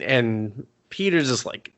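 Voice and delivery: usual speaking voice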